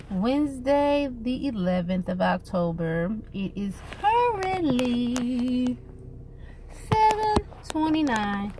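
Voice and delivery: normal talking voice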